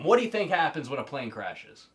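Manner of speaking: normal talking voice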